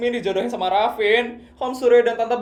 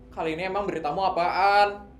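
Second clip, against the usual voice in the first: ordinary talk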